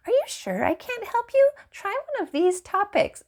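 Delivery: high mocking bot voice pleading; mock chatbot. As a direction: parody the pushy chatbot.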